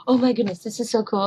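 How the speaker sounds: normal talking voice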